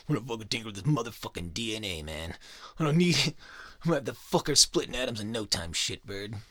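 hammed-up gleeful scientist bragging; manic mad scientist. God boasts about tinkering DNA.

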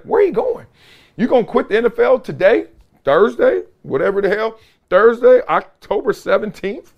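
high, amused where-you-going, laughing underneath; half-laughing high incredulous questions; incredulous mock disbelief. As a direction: tease him like he's lost his mind. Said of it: "made me laugh on the inside".

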